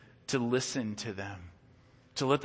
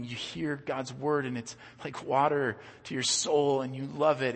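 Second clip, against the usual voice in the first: ordinary talk, nothing marked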